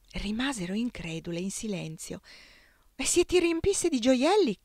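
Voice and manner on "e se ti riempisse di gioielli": high, louder daring concubine asking; a daring young wife's eager question; bold curious question. A narrator's reading. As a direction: tease her with the offer, boldly. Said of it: "chiese la più audace".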